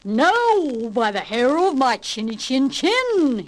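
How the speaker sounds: furious roar. Acted. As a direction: roar in a rage, dragging the words. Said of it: "he roared".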